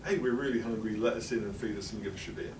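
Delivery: usual speaking voice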